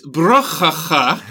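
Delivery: put-on slow, heavy Russian accent; "Bruchacha" in thick mock Russian accent. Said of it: "ridiculously stereotypical Russian accent".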